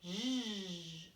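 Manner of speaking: "J" sustained as a buzzing "zh"; isolated first sound. Shown as a demonstration. Teacher prompts rhyming words for parents and children, "J" as long "zh". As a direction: draw out "J" as the consonant sound alone. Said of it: "des mots qui chantent comme jeudi".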